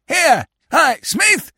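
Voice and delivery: high, indignant shouts; outraged young man yelling after someone; calling loudly. A narrator's reading. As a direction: hail him urgently from behind. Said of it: "who called loudly".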